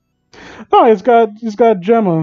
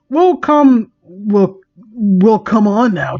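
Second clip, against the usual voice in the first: coaxing character voice; 3 semitones higher, much slower than usual. Acted.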